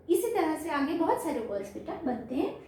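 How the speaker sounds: ordinary delivery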